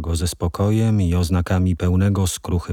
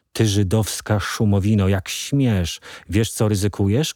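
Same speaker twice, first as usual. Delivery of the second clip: ordinary delivery, nothing marked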